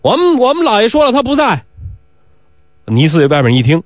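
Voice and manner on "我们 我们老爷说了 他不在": high call through the door; calling outside. Acted. Doorman turning away the caller, calling out. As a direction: pitch it up for someone outside. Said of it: "门搭子对外面喊".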